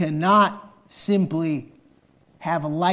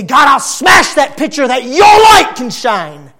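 shouted prayer; 12 semitones higher, 12 dB louder than usual. Acted. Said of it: "To say".

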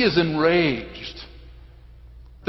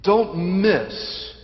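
ordinary delivery

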